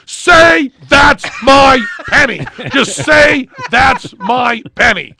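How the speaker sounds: loud, slow, hammered demand; yelling. Retold or imitated. Magician yelling at patient to say the line, slow yell. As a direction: yell each word at him slowly. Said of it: "he's yelling at me".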